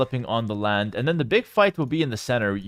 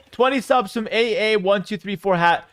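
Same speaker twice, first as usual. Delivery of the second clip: higher-pitched, driving esports commentary; hype cast; 4 semitones higher than usual. Shown as a demonstration. Caster to viewers after twenty gifted subs, hype casting. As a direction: hype up every move for the viewers. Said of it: "hype cast five minutes".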